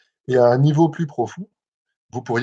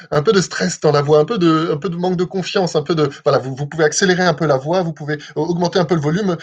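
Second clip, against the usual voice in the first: higher, louder, under pressure; stressed voice; 6 semitones higher, 5 dB louder than usual. Shown as a demonstration. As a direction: let stress and shaky confidence push the voice up. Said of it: "faites une voix un peu stressante".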